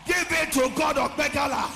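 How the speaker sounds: high, loud preaching cry; preaching shout. Real, not acted. Preacher crying out to the church, loud and high.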